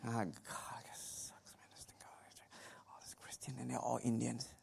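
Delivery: whispered grumbling under the breath; a whispered curse from the back of church; muttered curse. Retold or imitated. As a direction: hiss the complaint so nobody hears. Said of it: "I'm cursing".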